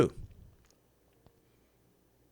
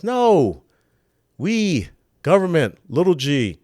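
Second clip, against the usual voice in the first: louder, higher, word-by-word assertion; mock emphatic correction; 5 semitones higher, 5 dB louder, slower than usual. Host posing as government claiming God's role, mock emphatic correction. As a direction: overrule them in a hammed-up declaration. Voicing government overruling God.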